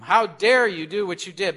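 loud, indignant scolding demand; angry rebuke. Pretend. Prophet confronting King David, loud indignant rebuke. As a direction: tear into him with the question. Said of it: "lay right into him".

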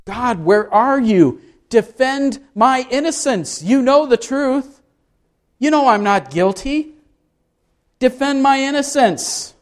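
high desperate plea to God; pleading cry. Acted. Job crying out to God, high pleading voice. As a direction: beg God to clear his name. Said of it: "he cries out to God".

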